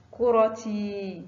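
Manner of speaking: كرتي read slowly with the final ي stretched long; drawn-out example word. Shown as a demonstration. Teacher demonstrating a long vowel to young pupils, slow and drawn out. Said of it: "teacher demonstrates long ii".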